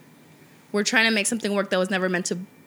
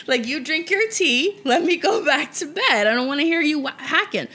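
mock grumpy partner; 5 semitones higher than usual. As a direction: whine it like a sleepy boyfriend. Mocking partner's late-night complaints.

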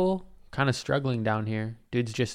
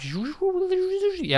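squeaky yeah. Pretend. Silly high squeak.

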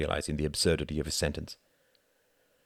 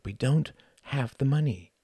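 higher, weary reminder; sighed; 6 semitones higher than usual. A narrator's reading. Ruth to Quentin about paying the fine, sighed. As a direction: sigh it out with pitch lifted. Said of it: "sighed Ruth".

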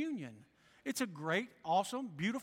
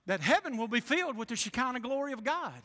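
usual speaking voice

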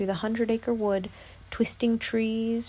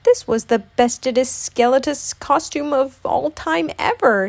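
loud, higher voice with mock sorrow; sniffling Tigger; 5 semitones higher, 5 dB louder than usual. A narrator's reading. Tigger over his bedraggled costume, sniffing sadly. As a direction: lament it as a cartoon tiger. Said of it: "he sniffed".

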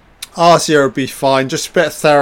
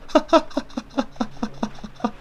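a high, airless giggle at the screen; high breathy laugh; 11 semitones higher, 7 dB quieter than usual. Real, not acted. Watching a gory film scene, a high breathy laugh. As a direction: let a high, unvoiced laugh slip out.

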